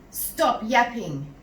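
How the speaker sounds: slow, loud boom. A narrator's reading. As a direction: boom it out slowly and sternly. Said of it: "Mister Cripps boomed".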